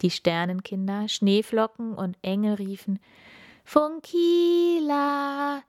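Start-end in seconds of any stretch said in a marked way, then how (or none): 3.6-5.6 high, slow, drawn-out call; a far-off choir of star children calling. Acted. Star children calling Funkila home, high and drawn-out.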